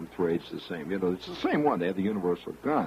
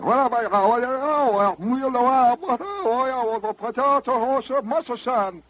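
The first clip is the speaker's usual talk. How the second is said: much higher, slow, stammering mock dub voice; badly dubbed actor; 6 dB louder than usual. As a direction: play the lip-sync of a badly dubbed film actor. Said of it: "he's obviously saying something".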